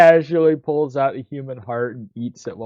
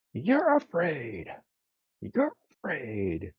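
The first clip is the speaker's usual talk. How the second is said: deathcore growl; 9 dB quieter, slower than usual. Acted. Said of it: "That does sound like deathcore".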